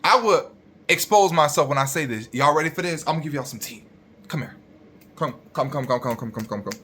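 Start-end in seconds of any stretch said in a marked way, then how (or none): 4.3-6.7 hushed, rapid little repeats fading away; conspiratorial beckoning. Pretend. Creator calls viewers closer for some tea, conspiratorial. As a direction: draw them in for a secret, softly.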